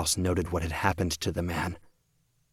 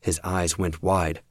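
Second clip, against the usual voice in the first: ordinary delivery, nothing marked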